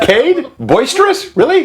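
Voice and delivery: high, rising incredulous questions; mock disbelief. Real, not acted. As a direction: tease him with disbelief. Teasing disbelief at a word choice.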